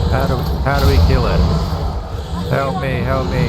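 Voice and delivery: fast, loud, high panic; panicked shout. Real, not acted. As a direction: yell for help in a frantic rush. Panicking during a boss fight.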